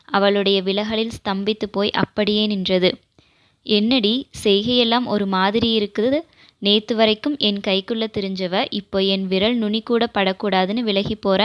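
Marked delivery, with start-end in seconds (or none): none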